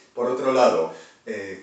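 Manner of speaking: ordinary talk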